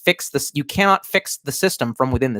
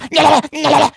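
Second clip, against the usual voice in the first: loud laugh; 14 semitones higher, 7 dB louder than usual. Real, not acted. Laughs at own impression.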